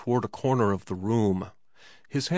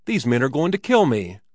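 louder, urgent accusation; swift and eager; 3 semitones higher, 6 dB louder than usual. A narrator's reading. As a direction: blurt it out eagerly. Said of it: "answered him swiftly and eagerly".

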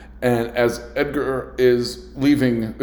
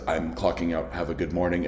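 calm quit; 4 semitones lower, 6 dB quieter than usual. Retold or imitated. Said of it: "looked at him and said".

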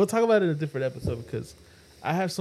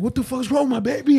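high, loud panicked parent; hammed-up alarm over the baby; mock panic; 9 semitones higher, 6 dB louder than usual. Parent freaking out over the baby's lips, mock panic, high and loud.

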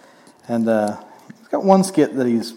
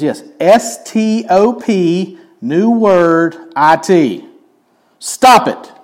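S T O P spelled slowly, then Stop it higher and louder; 7 semitones higher, 6 dB louder, much slower than usual. Retold or imitated. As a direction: spell the letters one by one, then snap the order. Counselor spells out the words.

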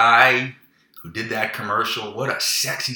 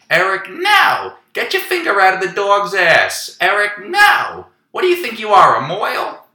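mother screaming; 10 semitones higher, 7 dB louder than usual. Retold or imitated. Son imitates his mother screaming at him for misbehaving, high loud shriek. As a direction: shriek it like a fed-up mother scolding her kid. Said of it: "my mother screaming at me".

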